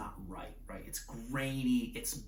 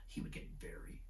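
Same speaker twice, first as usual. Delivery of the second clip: quiet whisper; 15 dB quieter than usual. Shown as a demonstration. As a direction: drop to a whisper, slowing on the second very. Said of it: "like this".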